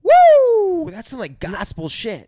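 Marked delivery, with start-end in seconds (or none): 0.0-0.9 high, loud drawn-out "woo"; excited whoop. Real, not acted. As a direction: let out a big cheering whoop. Whooping at the quoted narration.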